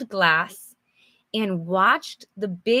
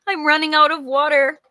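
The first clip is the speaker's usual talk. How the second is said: laughing, higher and louder, fast; 6 semitones higher, 6 dB louder, faster than usual. Real, not acted. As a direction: giggle through the line. Laughing joke about water cup.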